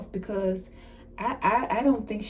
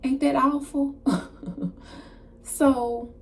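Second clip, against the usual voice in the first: ordinary talk, nothing marked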